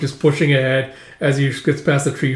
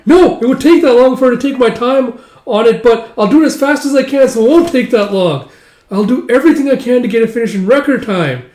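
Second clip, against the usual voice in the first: high, loud flustered insistence; loud, hurried-sounding backpedalling promise; frantic protest; 10 semitones higher, 7 dB louder than usual. Acted. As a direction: protest in a panic, pitched way up. Frantic protest at the long estimate.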